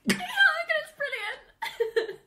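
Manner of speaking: very high, excited squeal; gushing delight. Real, not acted. Fan tells how the two friends hug, squealing with delight.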